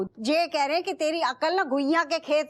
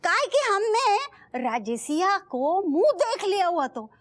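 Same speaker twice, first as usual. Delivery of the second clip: exasperated insistence; 6 semitones higher than usual. Acted. Insists to him, exasperated argument.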